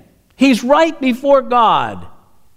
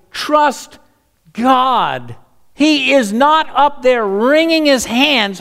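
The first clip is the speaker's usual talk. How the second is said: ordinary talk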